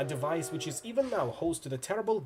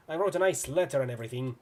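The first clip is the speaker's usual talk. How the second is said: normal talking voice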